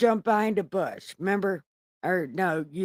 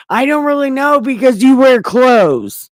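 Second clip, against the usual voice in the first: loud, higher hammed-up yell; Adam shouting his excuse at God; mock defensive shout; 6 semitones higher, 12 dB louder than usual. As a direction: blurt it out in a panic.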